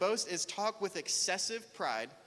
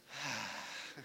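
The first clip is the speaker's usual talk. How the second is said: long, soft sigh of relief; relieved sigh; 7 semitones lower, 8 dB quieter than usual. Retold or imitated. Pastor acting out relief at cancelled plans, soft sigh. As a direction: let out a big private sigh of relief.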